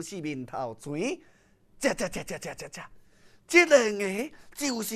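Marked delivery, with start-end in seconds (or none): none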